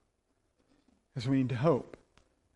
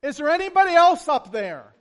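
loud, higher mock-desperate shout; shouted punchline; 10 semitones higher, 8 dB louder than usual. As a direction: yell upward for a second opinion. Jack's punchline shouted upward.

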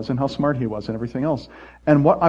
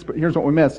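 usual speaking voice

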